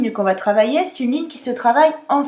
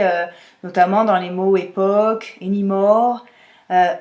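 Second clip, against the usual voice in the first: ordinary talk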